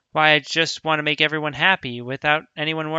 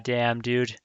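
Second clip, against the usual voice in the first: usual speaking voice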